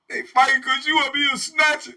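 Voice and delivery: loud, high holler; hollered from a cell. Retold or imitated. Inmate hollering at the warden from a cell, loud. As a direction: call out from behind the door. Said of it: "somebody hollered out the door".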